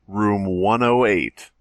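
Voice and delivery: ordinary delivery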